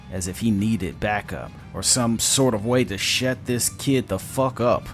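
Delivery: plain reading voice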